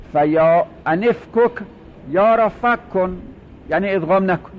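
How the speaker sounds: ordinary delivery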